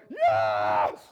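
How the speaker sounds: loud squeal of a thrilled fan; mock fan scream. Ladies cheering their boy band, loud mock scream. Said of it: "ladies my age were like".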